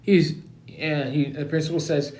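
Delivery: ordinary delivery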